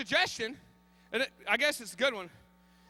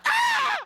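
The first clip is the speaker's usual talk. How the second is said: sudden high scream, much louder; high-pitched yelp of pain; comic scream; 8 dB louder than usual. Retold or imitated. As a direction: shriek from a bug bite. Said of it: "I'm like".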